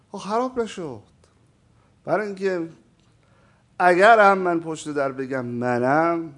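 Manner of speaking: usual speaking voice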